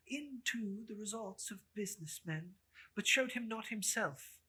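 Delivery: usual speaking voice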